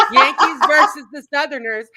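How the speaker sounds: high, laughing words that break into giggles; laughing at the Yankees-versus-Southerners joke. Real, not acted. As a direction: chuckle your way through it.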